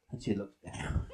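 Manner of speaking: very low, soft voice; low looking down. Acted. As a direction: drop right down with her gaze. Horse looking down, acted by pitch.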